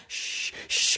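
unvoiced hushing; shushing. Husband shushing the kids amid scared passengers. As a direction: hush them urgently under your breath. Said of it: "my husband's like".

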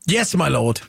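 low, deferential servant; lightly voiced Renaissance servant. Co-host playing along with the festival giveaway, lightly servile Renaissance voice. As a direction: answer lightly as an obedient servant. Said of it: "[name], your example?".

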